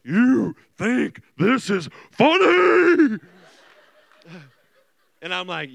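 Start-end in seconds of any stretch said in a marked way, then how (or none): 0.0-3.2 very loud, slow monstrous scream; hammed-up enraged mother, drawn out to the end; evil mom, worse. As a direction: roar it like a possessed parent. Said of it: "it got worse".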